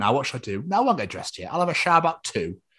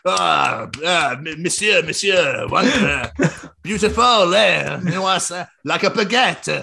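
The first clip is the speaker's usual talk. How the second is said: put-on higher-pitched applauding Frenchman, French accent; hammy French accent; 4 semitones higher, slower than usual. Co-host applauding host's weight loss as a Frenchman, mock French accent. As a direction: gush haltingly in a cartoon French accent. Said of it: "you've been a Frenchman who applauds".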